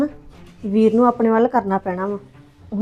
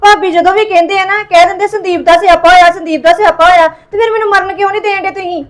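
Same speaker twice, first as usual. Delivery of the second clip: higher and louder, aggrieved complaint; higher, louder wounded outcry; 5 semitones higher, 4 dB louder than usual. Acted. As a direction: hurl the complaint at him. Staged suicide, accusing sister-in-law.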